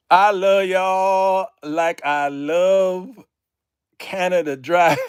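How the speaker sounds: loud, drawn-out gushing; effusive declaration. Real, not acted. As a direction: proclaim your love, slow and big.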